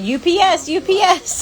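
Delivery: rising sing-song chant; mock sing-song call. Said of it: "They said".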